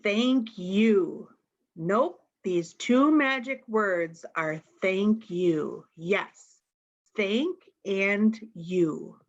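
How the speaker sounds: ordinary delivery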